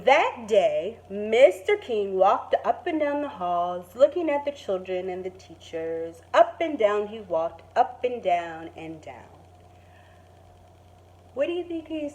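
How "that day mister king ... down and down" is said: gentle storybook narration; calm picture-book reading voice; reading to children. Acted. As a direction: read it like story time for kids. Teacher reads picture book aloud.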